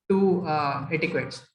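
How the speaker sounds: usual speaking voice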